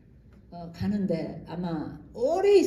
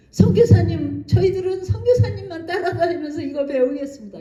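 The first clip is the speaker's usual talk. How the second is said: raised, much louder eager plea; eager young men; 5 semitones higher, 8 dB louder than usual. Retold or imitated. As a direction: beg to follow him everywhere. Young men eagerly begging to follow.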